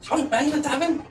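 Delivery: high puppet voice; high-voiced little jester, questioning. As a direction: pitch it up like a talking toy.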